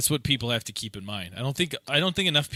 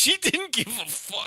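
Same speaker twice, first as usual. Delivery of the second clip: high mock voice; 12 semitones higher than usual. Voicing the indifferent neighbour.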